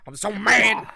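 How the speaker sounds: loud mock-furious outburst; mock angry. Host acts out the angry boyfriend, mock-furious outburst. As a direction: blurt it out in comic fury. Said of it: "he's very angry all the time".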